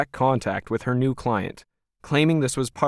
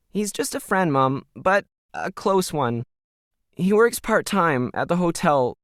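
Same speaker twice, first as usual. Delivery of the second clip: put-on higher young girl's voice, distressed and close to tears; a frightened daughter blurting bad news to her mom; upset teen daughter; 10 semitones higher than usual. A narrator's reading. Emily voiced in read story.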